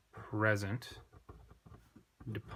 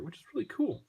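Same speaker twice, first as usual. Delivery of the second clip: delighted; 12 semitones higher, faster than usual. Real, not acted. Greek teacher thrilled by a neat accent rule, excited. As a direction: pitch jumps much higher on really cool.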